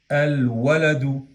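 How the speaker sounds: "al waladu" slow, L sounded before the w; lam pronounced. Shown as a demonstration. Teacher demonstrating the article before waw, slowly pronounced lam. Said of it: "en este caso pronunciamos la lam de al".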